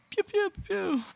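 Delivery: high breathy pew pew; high soft pews. Acted. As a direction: fire off soft high lasers. Sound effect after intro.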